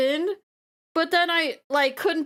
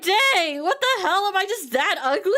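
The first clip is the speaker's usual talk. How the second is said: mock shocked fiancé; 6 semitones higher than usual.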